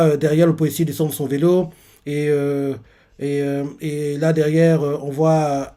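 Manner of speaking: normal talking voice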